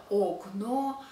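окно as "OK-no", first o fully sounded; over-pronounce the first o of окно; wrong full o. Shown as a demonstration. Teacher showing what isn't said, окно with full o.

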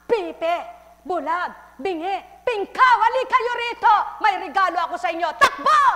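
high, loud, urgent call; father's loud call. A narrator's reading. Father calling the afflicted to come, loud and high. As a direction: call them all home at full voice. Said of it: "Sabi ng ama".

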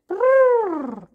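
loud high trumpeting brrr; elephant trumpet. Shown as a demonstration. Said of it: "Elephant sound".

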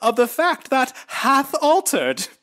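high, slow, solemn biblical preaching; lofty old-timey Jesus voice, drawn out. Retold or imitated. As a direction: intone like a pompous prophet. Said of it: "That's his Jesus".

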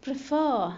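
Prefer, second syllable stronger and falling; stress on second syllable. Shown as a demonstration. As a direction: lean hard on FER in Prefer. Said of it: "صوته أعلى".

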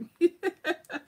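high, loud amused laugh. Real, not acted. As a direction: laugh at the husband's rush. Laughing at the husband's hurry.

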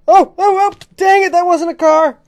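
high, loud exclaim; excited frustration. Real, not acted. Player exclaiming after missing the car, frustrated. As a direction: groan it out loud.

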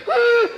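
short high loud scream of fright; high-pitched jump-scare cry; startled yelp. Retold or imitated. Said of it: "She scared me".

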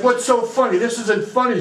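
lightly acted indignant demand; mock indignation. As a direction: imitate offended onlookers challenging him. Onlookers scold the laughing priest.

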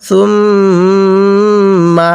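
সুম্মা with the doubled mim held long through the nose; nasal ghunna. Shown as a demonstration. Teacher shows the students সুম্মা with a long nasal ghunna. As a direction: hum the mim in সুম্মা, very slow and drawn out. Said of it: "এই মিমটিকে গুণনা করতে হয়".

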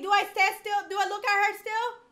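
high, loud, urgent questions; panicked questioning. Real, not acted. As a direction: cry out for instructions in panic. Panicked questions during game threat.

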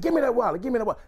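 high-pitched, insistent demand; retold holler. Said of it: "He's hollering as he's telling me this".

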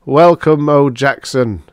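loud, high ceremonial hail; reverent robot. A narrator's reading. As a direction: hail your idol reverently. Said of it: "the clunker said reverently".

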